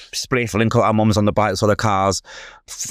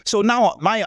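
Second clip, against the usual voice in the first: normal talking voice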